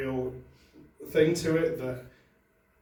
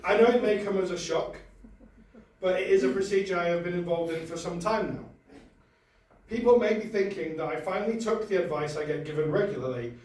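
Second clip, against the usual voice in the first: mock-solemn prepared statement, higher and louder; formal statement; 3 semitones higher, 5 dB louder than usual. Comic reading a prepared statement to the audience, mock-formal. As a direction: announce it like a press release.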